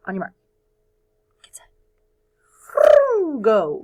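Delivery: mock starting gun call; excited race start.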